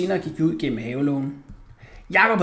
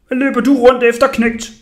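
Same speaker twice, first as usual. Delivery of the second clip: loud, raised grown-up challenge; stern put-on man; 8 semitones higher, 10 dB louder than usual. A narrator's reading. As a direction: confront the boy loudly as a pretend man. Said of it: "sagde jeg med min dybe stemme".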